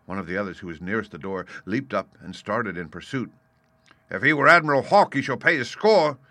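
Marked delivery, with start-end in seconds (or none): 4.1-6.2 loud, high indignant outburst; crying out. A narrator's reading. Landlord vowing the runaway shall pay, crying out. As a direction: declare it hotly to the whole tavern. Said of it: "cried Silver".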